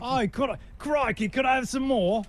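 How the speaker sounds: very high mock orphan with an Aussie twang; Oliver Twist. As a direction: beg in a hammed-up Australian-English accent. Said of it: "I think that's Oliver Twist".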